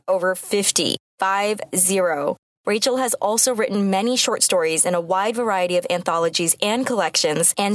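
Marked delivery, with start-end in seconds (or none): 0.1-2.4 "Five zero" spelled out slowly for emphasis; stress on Five zero. Real, not acted. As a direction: land "Five zero" digit by digit. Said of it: "Yeah, you heard it right".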